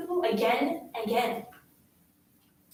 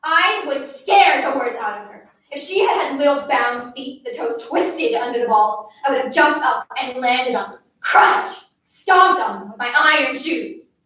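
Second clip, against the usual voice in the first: loud, forceful storytelling; vehement rage; 8 dB louder than usual. A narrator's reading. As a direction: relive the fury, hitting the violent words hard. Narrator relives violent rage at girl.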